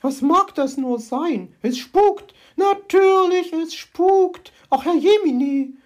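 very high, loud frightened squeal; panicky magpie. Acted. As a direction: squeal in ghostly terror. Magpie panics about ghosts.

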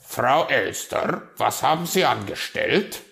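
loud, reproachful demand; stern raven. A narrator's reading. Raven discovering the damaged hedgehog figure, stern. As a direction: call her to account sternly. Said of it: "fragte er streng".

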